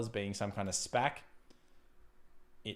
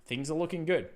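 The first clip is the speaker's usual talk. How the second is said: upbeat sing-song; 5 semitones higher than usual. Acted. Streamer cheering the chart to viewers, upbeat sing-song. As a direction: sell it like good news.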